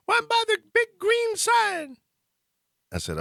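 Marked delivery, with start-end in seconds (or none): 0.1-2.0 high falsetto sobbing wail, hammed-up; mock a panicked woman bawling down the phone; imitating hysterical crying. Scared passenger sobbing to her driver on the phone, high mock wail. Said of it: "and she's crying".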